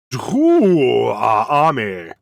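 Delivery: slow booming reveal of a name; dramatic announcer. Acted. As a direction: draw it out like a grand announcer. Dramatic announcement of new army.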